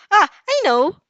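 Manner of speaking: high, loud sudden idea; yelling. A narrator's reading. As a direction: shout out the bright idea. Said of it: "she lastly yells".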